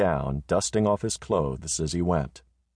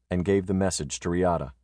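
usual speaking voice

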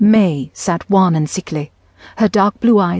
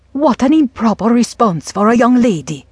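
higher-pitched prim telling-off; scolding, with a higher lilt; chiding mother; 5 semitones higher, 3 dB louder than usual. A narrator's reading. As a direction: chide a daughter for improper talk. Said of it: "mother chided".

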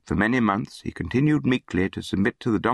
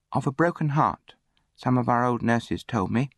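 put-on somewhat higher child's reply; lightly voiced orphan boy; 6 semitones higher than usual. A narrator's reading. Orphan answers about his dead mother, high earnest reply. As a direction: answer simply, pitched up like a boy.

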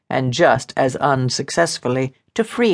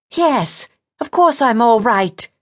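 high-pitched, irritated woman's retort; snapping; 10 semitones higher than usual. A narrator's reading. Diana's temper rises to meet his, snapping. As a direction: snap back in a raised, higher voice. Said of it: "Diana snapped".

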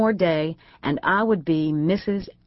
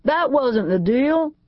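calling after someone; 8 semitones higher, 4 dB louder, slower than usual. A narrator's reading. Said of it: "he called after me".

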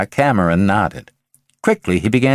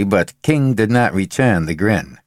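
usual speaking voice

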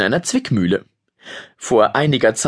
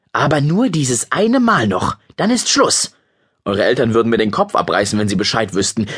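usual speaking voice

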